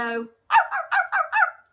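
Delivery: loud, very high puppy yaps; little puppy yap. Pretend. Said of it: "Or a little puppy might go".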